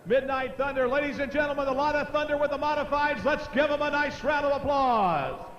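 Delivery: tractor pull announcer. Real, not acted. Announcer closes class, calls applause.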